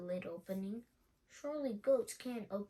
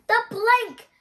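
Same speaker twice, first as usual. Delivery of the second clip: high, loud pirate yell; 13 semitones higher, 17 dB louder, slower than usual. A narrator's reading. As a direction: scream it out furiously. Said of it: "yelled Ben Buckle and Percy Patch".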